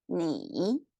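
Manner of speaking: "你" dipping low, then rising; careful third-tone "你" for learners; demonstrated Mandarin tone. Shown as a demonstration. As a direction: sink and climb through "你". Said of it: "learn a sentence".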